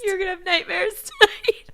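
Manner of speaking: laughing through tears. Real, not acted. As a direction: say it while laugh-crying. Laughing, teary.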